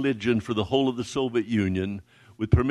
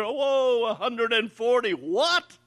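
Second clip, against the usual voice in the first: higher, thrilled "a hundred and forty"; excited disbelief; 9 semitones higher, slower than usual. Retold or imitated. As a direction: burst out in happy amazement. Hearing the huge Bible request.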